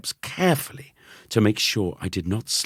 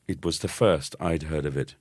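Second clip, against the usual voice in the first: ordinary delivery, nothing marked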